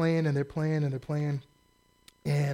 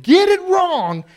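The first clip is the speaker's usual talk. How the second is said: higher, louder, slow jab; scornful outburst; 10 semitones higher, 11 dB louder, slower than usual. Real, not acted. Preacher mocking false prophets, loud jab. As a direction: hit it with scorn.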